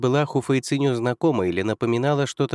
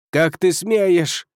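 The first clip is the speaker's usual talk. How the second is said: outraged; 7 semitones higher, 5 dB louder, much slower than usual. Acted. Gulian yells in outrage.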